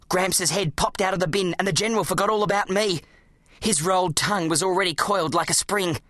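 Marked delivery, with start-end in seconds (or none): none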